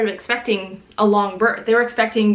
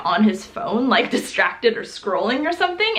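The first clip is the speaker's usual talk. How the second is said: normal talking voice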